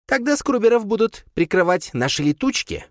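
high squeaky suggesting voice; squeaky creature. A narrator's reading. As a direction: offer it eagerly. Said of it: "предложил Крисп".

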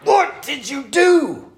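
high, slow, mock outrage; hammed-up husband blowing up over the money; mock outraged husband. Husband snapping at Becky over spending, mock outrage. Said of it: "I can't be like".

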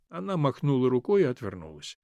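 plain reading voice

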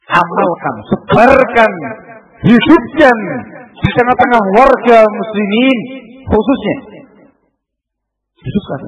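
rising loud call to act; rally the congregation; fervent exhortation. Acted.

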